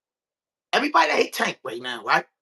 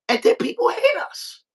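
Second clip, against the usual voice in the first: much higher, mock complaining voice; high put-on voice. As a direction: squeak it out like a whiny mock voice. Mocking how others see them.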